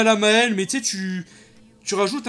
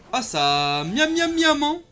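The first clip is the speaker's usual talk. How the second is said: slow miam then a much higher, playful miam miam; gleeful yum; 7 semitones higher, slower than usual. Real, not acted. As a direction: relish it like a treat. Damage numbers as food. (gourmand et moqueur, de plus en plus aigu)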